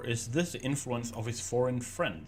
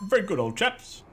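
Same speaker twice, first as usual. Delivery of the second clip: put-on posh British accent; 8 semitones higher, 6 dB louder than usual. Mock posh British quip.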